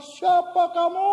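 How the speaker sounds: high, slow shout across a ravine; imitated kid's loud challenge into the distance; child calling out. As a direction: holler the question like a kid, stretched out. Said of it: "Lalu anak ini bilang".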